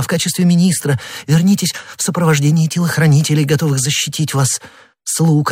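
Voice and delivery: high, desperate imploring; pleading queen. A narrator's reading. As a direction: beg him to flee, near tears. Anne keeps imploring. (королева умоляет герцога уехать)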